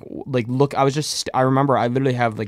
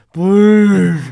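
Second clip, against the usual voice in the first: high, loud begging; desperate plea; 8 semitones higher, 12 dB louder than usual. Retold or imitated. High teen begging clinic staff to let him in, loud. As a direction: beg like your life depends on it.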